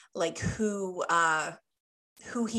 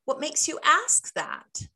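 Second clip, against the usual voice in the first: questioning comeback; 7 semitones higher, 4 dB louder than usual. Shown as a demonstration.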